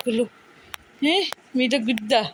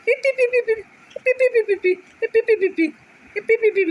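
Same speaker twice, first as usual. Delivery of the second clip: very high, bouncy pee pee pee; high squeaky pee sounds; 9 semitones higher than usual. Acted. Mother coaxing baby with toy, very high squeaky chant.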